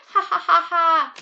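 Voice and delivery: loud, high, measured ha-ha-ha-ha; mock laugh. Shown as a demonstration. Teacher acting out the quiz word laughing, loud mock laugh. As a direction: act out a big deliberate laugh. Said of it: "Is he laughing?".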